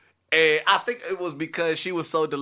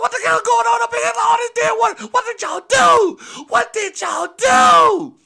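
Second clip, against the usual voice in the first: much higher, louder angry yelling; furious shout; 12 dB louder than usual. Retold or imitated. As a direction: demand answers at full volume. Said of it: "He's like".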